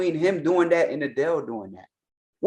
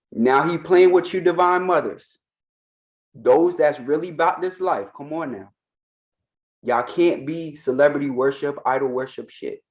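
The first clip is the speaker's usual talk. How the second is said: normal talking voice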